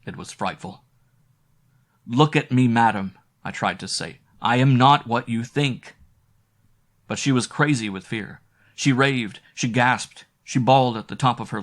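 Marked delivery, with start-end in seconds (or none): 2.1-6.0 loud, desperate pleading; urgent plea. A narrator's reading. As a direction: shout to be heard over her screams. Narrator pleads with screaming woman.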